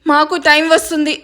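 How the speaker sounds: ordinary delivery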